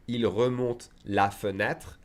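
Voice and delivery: "fenêtre" with every syllable, slow; slowly. Shown as a demonstration. Teacher demonstrating the slow careful version. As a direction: say "il remonte la fenêtre" slowly, all the e sounds.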